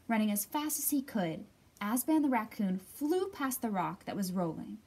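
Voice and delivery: ordinary delivery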